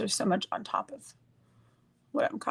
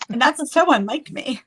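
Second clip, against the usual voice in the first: laughing; 4 semitones higher, 9 dB louder than usual. Real, not acted. Laughing at herself.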